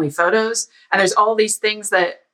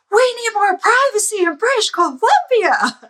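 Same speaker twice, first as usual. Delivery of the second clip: much higher, louder alarmed plea; sudden urgent convert; 4 dB louder than usual. Retold or imitated. Guy suddenly won over, calling high and urgent for privacy. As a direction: blurt it as if suddenly panicked.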